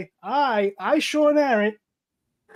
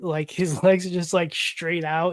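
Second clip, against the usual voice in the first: ordinary talk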